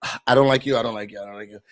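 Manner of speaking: whiny, fed-up sing-song; mocking their bickering; mock disgusted couple. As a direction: sneer it like a bored partner.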